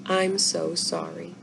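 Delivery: soft, slow, tearful apology; whispered sorry to the dying animal; sad whisper. Acted. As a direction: barely get it out through grief. Izzy grieving over the possum.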